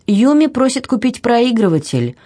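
calling from the kitchen; from another room. Acted. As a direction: voice carried from the next room. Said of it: "послышался из кухни голос жены".

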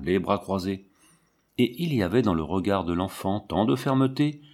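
usual speaking voice

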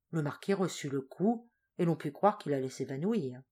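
plain reading voice

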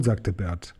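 plain reading voice

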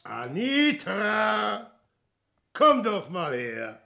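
drawn-out name, then beckoning; singsong summons; calling out. Acted. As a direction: call her over, stretching the name. Calls Anitra over.

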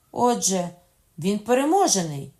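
high, ringing cry; loud exclamation. A narrator's reading. Queen mother reacts to the escape, loud exclamation. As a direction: call it out forcefully.

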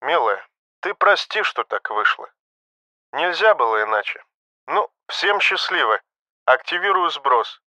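soft, resigned goodbye; gentle farewell. A narrator's reading. Doomed pilot apologising to his beloved, gentle farewell. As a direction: apologise tenderly, quietly accepting it.

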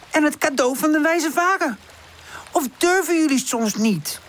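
high, pressing character voice; daring challenge. A narrator's reading. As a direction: goad your friends into going. Woezel presses and dares them.

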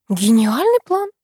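slow, sighed sarcastic praise; sighing. A narrator's reading. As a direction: draw it out with a weary lift. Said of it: "вздохнула Красавина".

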